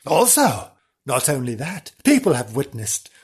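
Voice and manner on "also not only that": ordinary delivery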